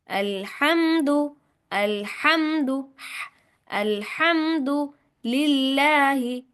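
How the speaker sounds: अल्हम्दु slow, with a clear throat ha; drawn-out recitation. Shown as a demonstration. Teacher breaks the word down for students. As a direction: stretch अल्हम्दु syllable by syllable. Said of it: "दोहराएं, सुनाएं".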